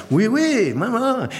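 high-pitched imitation of eager boys; schoolboys' reply. Schoolboys answer the old lady in French, high and eager. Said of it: "they'd be like".

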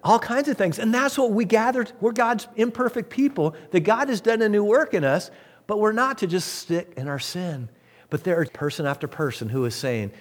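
usual speaking voice

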